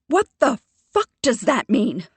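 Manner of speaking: loud, high, sharp cry; sharp outburst. Acted. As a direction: lash out at him. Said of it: "My voice is so sharp".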